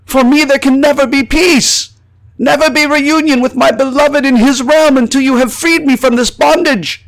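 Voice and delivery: high, loud, anguished plea; impassioned high-pitched shouted protest; calling out. A narrator's reading. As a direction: cry out desperately to be heard. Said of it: "I remember calling out to them".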